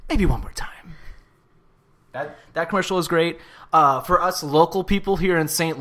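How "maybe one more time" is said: sly whispered line; unvoiced mischievous whisper. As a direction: whisper it like a guilty secret. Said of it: "And he's like".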